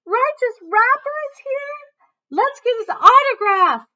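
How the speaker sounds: high, loud fan squeal; excited scream. A narrator's reading. Girl in the crowd screaming for an autograph, shrill excited shout. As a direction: shriek it like a starstruck girl. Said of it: "Then one girl screamed".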